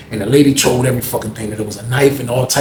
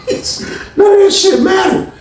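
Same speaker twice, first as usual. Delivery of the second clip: laughing outburst. Real, not acted. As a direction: burst out laughing at how it all turned out.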